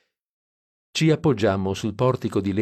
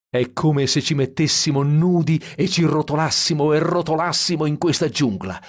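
higher-pitched, eager outburst; excited exclamation; 6 semitones higher, 3 dB louder than usual. A narrator's reading. Dean proposing a naked roll in the jungle, excited. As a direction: pitch it up with wild enthusiasm. Dean's wild idea of rolling naked.

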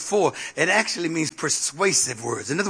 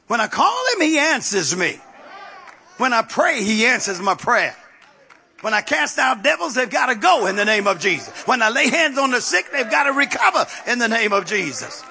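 rhythmic declarations of faith to a congregation; preaching; 4 semitones higher than usual. Real, not acted. Preacher testifying that God answers prayer, preaching. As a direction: pitch leaps on each "when I", then settles.

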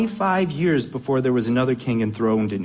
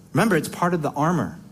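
usual speaking voice

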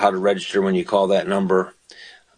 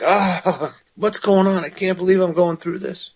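higher, pleading voice of a troubled psalmist; distressed psalmist; 8 semitones higher than usual. Retold or imitated. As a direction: plead it up high as the despairing psalmist. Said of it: "He's like".

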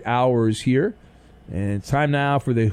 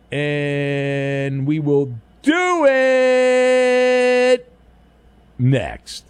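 announcer voice; much slower than usual. Acted. Dramatic tease before break.

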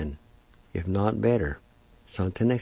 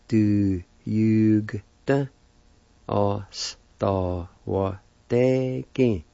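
du jugte oss da var deg dragged out slowly, falling on deg; very slow syllables; much slower than usual. Shown as a demonstration. Teacher joining both parts, very slow for students. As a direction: stretch every syllable for the learners. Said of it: "And together".

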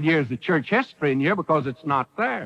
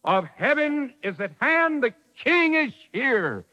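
prophet's proclamation; 7 semitones higher than usual. Retold or imitated. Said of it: "John the Baptist is saying".

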